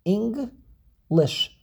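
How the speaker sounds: "English" in two separate beats; teacher's slow split of "English"; syllable by syllable. Shown as a demonstration. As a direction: break "English" after "Eng". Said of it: "Two syllables".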